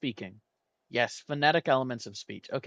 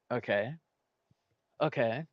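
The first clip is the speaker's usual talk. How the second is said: normal talking voice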